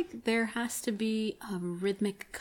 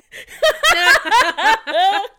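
loud high burst of laughter; 11 semitones higher, 15 dB louder than usual. Real, not acted.

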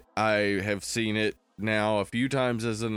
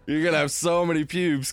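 laughing; 5 semitones higher, faster than usual. Pretend. Answering as kid, laughing.

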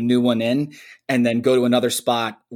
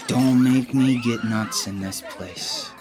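lightly voiced Pesci, slow and laughing; a slow, half-laughing take on Pesci's threat; a hint of Pesci, chuckling through it; Pesci impression; slower than usual. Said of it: "Everything that Pesci does in that scene".

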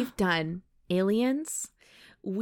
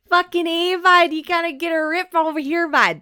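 hammed-up Canadian accent, louder; 6 semitones higher, 6 dB louder than usual. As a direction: play the rowdy Canadian guy with a Canadian accent. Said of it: "Are you doing a Canadian accent?".

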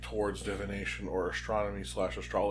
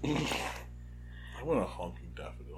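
soft laughing whisper; whispered joke; 3 semitones higher, 5 dB quieter, slower than usual. Real, not acted. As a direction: breathe it out with a laugh.

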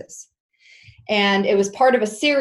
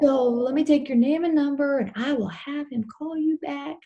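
high-pitched Southern secretary; Southern Texas accent; 7 semitones higher than usual. Retold or imitated. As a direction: put on a reassuring Texas woman's accent, pitched up. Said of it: "I'm trying to do the accent".

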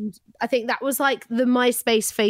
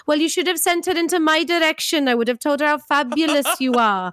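put-on higher, singsong Welsh accent; 4 semitones higher than usual. Parent praising the teacher to her manager, Welsh accent. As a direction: imitate a gushing Welsh parent. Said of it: "I realised I've gotten okay at it".

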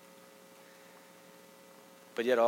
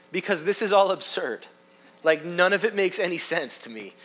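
imitating a fed-up student, louder and higher; exasperated student; 5 semitones higher, 5 dB louder than usual. Student telling the professor he's leaving seminary, exasperated. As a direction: act out a student's emphatic complaint.

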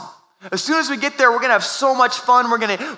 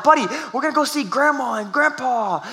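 put-on higher, pleading over a screaming kid; strained pleading; 5 semitones higher than usual. Dad pleading with his screaming son in the car, higher and strained. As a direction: try to calm him, voice pitched up and strained.

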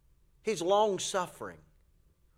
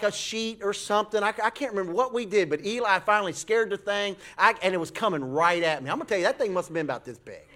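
ordinary talk

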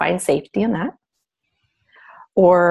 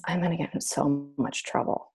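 put-on much softer, lower, like a scared child; hushed worried thought; 4 semitones lower, 10 dB quieter than usual. Sitting alone in the car, fearing trouble, hushed and scared. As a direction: hush it, fearing punishment.

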